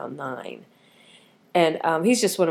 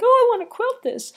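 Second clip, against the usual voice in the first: much higher, eager pleading; eager whine; 6 dB louder than usual. Retold or imitated. Voicing her impatience to start quilting, high and eager. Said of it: "it's like".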